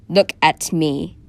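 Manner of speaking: loud, slow monster command; commanding creature. Acted. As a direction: order it like a towering beast. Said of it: "the creature ordered".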